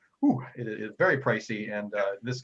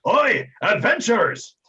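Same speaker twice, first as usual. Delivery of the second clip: brash sea captain, high and loud; Captain Tumult Viral; 9 semitones higher, 8 dB louder than usual. Acted. Captain hails the adventurers at the docks, loud brash shout. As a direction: hail them loudly like a brash old captain. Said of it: "a brash and well-bearded captain approaches".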